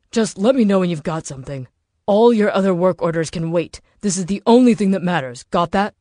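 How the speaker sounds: a commander barking orders at his engineer; firm order. Acted. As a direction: lay down the law, push it. Jenkins gives a firm order.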